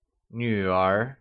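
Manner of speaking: "女儿" drawn out, dipping low then rising; falling-rising tone. Shown as a demonstration. Teacher models the word for daughter, slow "女儿" with falling-rising tone. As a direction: dip down and climb back up on "女儿", slowly. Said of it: "pronounced using the falling rising tone".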